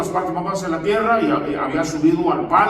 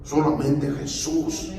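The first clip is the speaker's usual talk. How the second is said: normal talking voice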